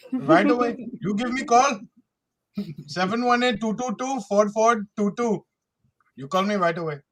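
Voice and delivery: playful Indian call-center pitch; Indian accent. Pretend. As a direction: put on an Indian salesman's accent. Acting Indian caller pitching warranty.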